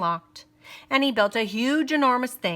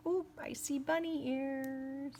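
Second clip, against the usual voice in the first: hushed aside; 4 semitones higher, 14 dB quieter than usual. Real, not acted. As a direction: softly point out the ears. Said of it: "aside to listeners".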